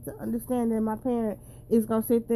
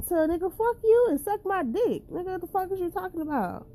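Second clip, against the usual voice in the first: pitched up high, voicing someone else's crude comeback; a higher, acted-out comeback; mock quoted retort; 8 semitones higher than usual. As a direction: throw the line back in a raised put-on voice. Quoting a crude exchange.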